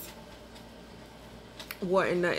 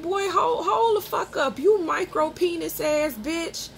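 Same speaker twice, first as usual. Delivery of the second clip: high-pitched, scolding put-down; outraged telling-off, acted out; retold angry comeback; 8 semitones higher than usual. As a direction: shut him down with the voice pitched right up. Retold insult during the quarrel.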